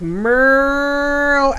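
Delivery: a cow voiced saying meow; lightly voiced cow; mock cow meow. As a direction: play the cow with a silly meow. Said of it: "this cow looks at him and is like".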